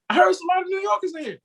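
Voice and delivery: high, hyped party shout-out; hyped DJ callout. Retold or imitated. Retelling the reggae DJ calling out New Yorkers, high hype. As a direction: hype the crowd. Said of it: "he was like".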